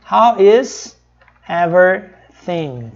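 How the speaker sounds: very slow, every word apart; slow careful teaching read; slow, separated. Shown as a demonstration. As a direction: say it slowly for learners. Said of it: "Só que eles não falam devagar assim".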